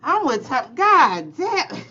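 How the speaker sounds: loud, high exclamation; loud high excited exclaim. Real, not acted. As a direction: shout it out while cracking up. Host cracks up at the exchange.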